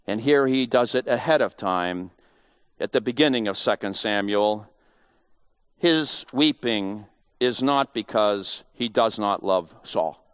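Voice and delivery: ordinary talk, nothing marked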